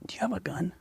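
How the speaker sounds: unvoiced whisper; hushed question about a gun. Real, not acted. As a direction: breathe it, no voice. Sudden whispered aside.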